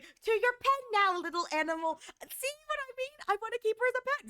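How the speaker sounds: high, teasing mock jailer; mock captor voice. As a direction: tease the prisoner like a pet. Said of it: "It was like".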